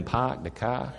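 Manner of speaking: soft, lightly put-on Boston "Park the car"; trying a Boston accent. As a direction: practice the Boston accent to fit in. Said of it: "I want to fit in".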